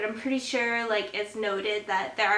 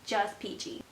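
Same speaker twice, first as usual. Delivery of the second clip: dry, much softer just peachy; sarcastic; 7 dB quieter than usual. Retold or imitated. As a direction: undercut it with deadpan sarcasm. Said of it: "And the guy goes".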